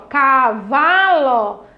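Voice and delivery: "cavalo" very slow, VA stretched and strongest; projected strong syllable. Shown as a demonstration. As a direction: draw out ca-VA-lo for a listener. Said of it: "Fale bem alto aí". ("cavalo" bem devagar, com "va" forte)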